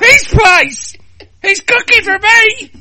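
very high, loud hammed-up squeal; excited shriek. Woman gushing to a friend about Phil, excited shriek. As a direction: shriek it at full pitch.

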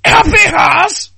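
long, drawn-out high shout; excited shriek. Acted. Friend answering in a comic script, hammed-up shriek.

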